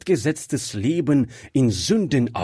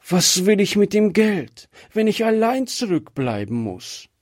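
sobbing; 8 semitones higher than usual. A narrator's reading. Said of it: "schluchzte sie".

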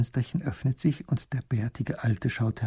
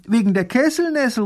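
eager call; 12 semitones higher, 7 dB louder than usual. Acted. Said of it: "ruft der kleine Großherzog".

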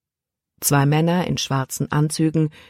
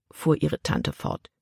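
usual speaking voice

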